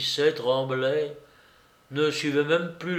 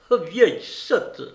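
high, self-scolding outburst; sudden exclamation; 6 semitones higher, 4 dB louder than usual. A narrator's reading. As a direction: burst out crossly in a raised voice. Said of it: "elle s'écria tout à coup". (exclamation soudaine et fâchée)

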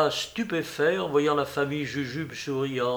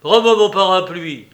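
angry demand; 5 semitones higher, 10 dB louder than usual. A narrator's reading. Concierge réclame son parapluie.

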